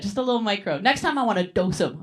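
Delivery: ordinary delivery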